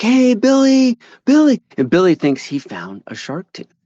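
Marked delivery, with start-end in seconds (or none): 0.0-1.6 high, loud imitated holler; calling out. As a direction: call a friend over from down the creek. Tom calling Billy over, retold.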